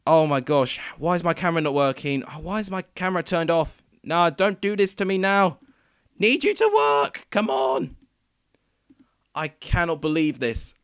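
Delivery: panicked frustration at gear failing live; exasperated outburst. Real, not acted. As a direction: plead with the broken camera, loud and fed up. Camera died mid-stream.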